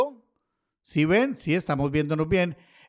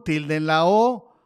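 usual speaking voice

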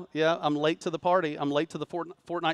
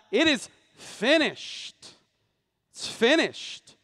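slow, higher, drawn-out cry of "It is finished"; Jesus crying out; 7 semitones higher, much slower than usual. Retold or imitated. Preacher voicing Jesus on the cross, slow and high. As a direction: let the words ring out slow and high like a final cry. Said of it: "he cried out".

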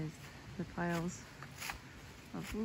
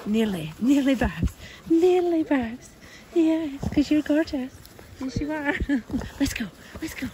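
high, loud cooing at a dog; doggy talk; 8 semitones higher, 9 dB louder than usual. Real, not acted. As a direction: praise the dog, gushing. Talking to the dogs.

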